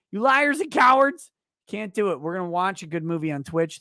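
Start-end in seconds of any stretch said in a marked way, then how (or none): 0.1-1.3 higher and louder, daring the chat; defiant loud rant. Real, not acted. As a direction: shout them down, refusing to back off. Taunting chat.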